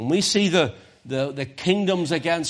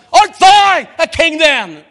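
THOU hurled out high and loud; emphatic sarcastic shout; 16 dB louder, slower than usual. Acted. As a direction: shout it as mocking interrogation, drawn out. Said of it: "That's the way it reads".

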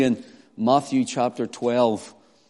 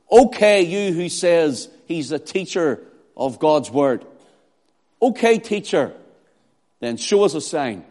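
sarcastic; 3 semitones higher, 4 dB louder than usual. Acted. Pharisees daring the teacher to show a sign, sarcastic. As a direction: challenge him with mock respect. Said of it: "more through sarcasm and cynical arrogance".

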